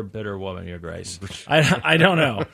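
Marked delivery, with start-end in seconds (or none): 0.0-1.2 deferential. Pretend. Servant playing to the king.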